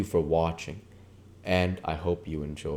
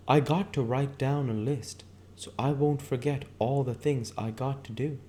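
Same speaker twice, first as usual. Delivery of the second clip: higher woman's voice rattling off a shopping list; Myrtle, showy and scatterbrained; 8 semitones higher than usual. Acted. Myrtle boasting to Mrs. McKee about her shopping list, higher giddy voice. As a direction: pitch up and list her wants, giddy and vain.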